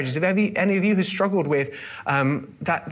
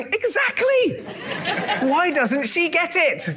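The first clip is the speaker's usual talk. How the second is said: high, slow, laughing exasperated husband; drawn-out mock husband agreement; imitating husbands; 12 semitones higher, much slower than usual. Preacher voicing husbands in the room, high slow laughing mock exasperation. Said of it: "every husband is going".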